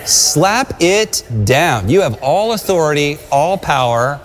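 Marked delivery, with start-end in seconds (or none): 0.1-1.9 drawn-out, firm and deliberate; very slow command. Shown as a demonstration. Preacher repeating the command very slowly to the church. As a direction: stretch every word for the congregation. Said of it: "Somebody say".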